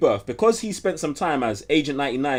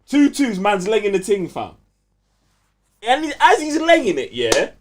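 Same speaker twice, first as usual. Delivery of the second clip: higher, louder, breathless retelling; excited storytelling; 6 semitones higher, 5 dB louder than usual. Real, not acted. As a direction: tell it like the best bit. Telling the show's climax excitedly.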